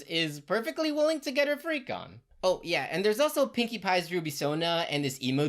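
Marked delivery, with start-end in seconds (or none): none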